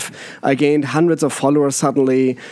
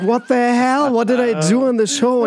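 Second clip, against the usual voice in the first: shocked; 9 semitones higher than usual. Retold or imitated. Shocked reaction retold.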